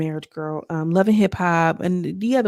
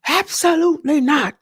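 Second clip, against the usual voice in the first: indignant denial; 9 semitones higher, 4 dB louder than usual. Retold or imitated. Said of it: "Why is it not a diabolical?".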